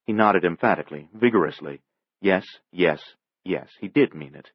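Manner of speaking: plain reading voice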